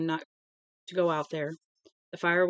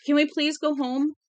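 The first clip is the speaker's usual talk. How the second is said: a lightly voiced little boy pleading, higher and a bit louder; tearful child; 8 semitones higher, 4 dB louder than usual. A narrator's reading. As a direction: beg to go home like a scared kid. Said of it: "Billy said through his tears".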